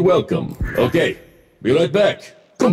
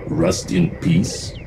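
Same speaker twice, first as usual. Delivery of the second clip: slow, much deeper deadpan pun; punchline; much slower than usual. Acted. Mech joking about a retired mech's plaque, slow deadpan. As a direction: land the joke slowly, deadpan.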